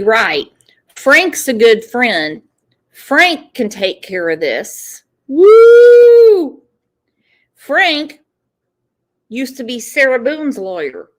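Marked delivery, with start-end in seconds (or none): 5.3-6.6 excited whoop. Real, not acted. Commentator cheers mockingly.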